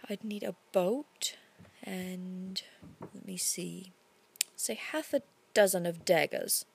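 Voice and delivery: hushed musing. A narrator's reading. Narrator thinking aloud, plotting.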